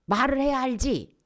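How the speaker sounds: high, loud mock complaint; exasperated outburst. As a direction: burst out in frustration. 답답해 따지는 사람 흉내. (버럭 따지는 말투 흉내)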